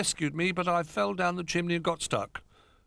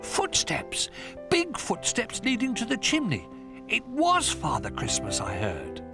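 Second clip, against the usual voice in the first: excited cub. A narrator's reading.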